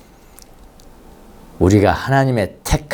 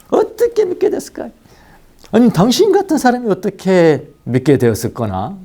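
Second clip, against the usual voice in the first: marveling; 10 semitones higher than usual. Acted. Acted quote of marveling believer.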